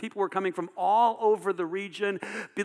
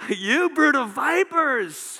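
high, drawn-out preacher's shout; loud, soaring denunciation; harsh shouted rebuke; 10 semitones higher, 8 dB louder, much slower than usual. Acted. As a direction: bellow the accusation slowly at full force. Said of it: "that's really harsh".

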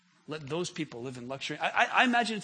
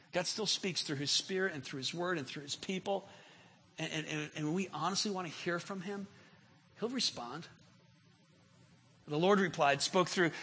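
ordinary delivery

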